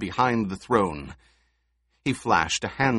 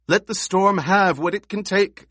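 high, loud scream of mock terror; 10 semitones higher, 5 dB louder than usual. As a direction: shriek it out, faking fear. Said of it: "she screamed".

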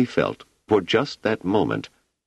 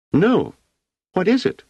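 put-on very high, eager child's voice; a small girl suddenly interested; curious little girl; 3 dB louder than usual. A narrator's reading. Wanda asks Hugo what the prime radiant is, high and curious.

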